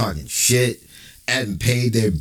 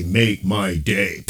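Make my day; 5 semitones lower, much slower than usual. Retold or imitated. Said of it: "Magnum and say".

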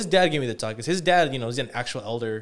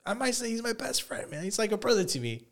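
mock-gushing impression of his friend, pitched up; mocking Mark, higher; 9 semitones higher than usual. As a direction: ham up the buddy's fawning.

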